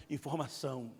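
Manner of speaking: ordinary talk, nothing marked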